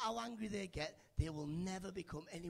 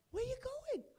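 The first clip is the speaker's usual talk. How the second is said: squeaky high call to someone leaving; 4 dB louder, slower than usual. Real, not acted. Comic calling to the woman leaving, high and surprised. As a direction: call after her, pitched way up.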